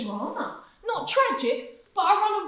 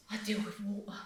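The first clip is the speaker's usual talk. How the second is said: whispered, almost reverent; hushed confession; 9 semitones lower, 15 dB quieter than usual. Acted. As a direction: breathe it out as a proud secret. Confiding his noble trade.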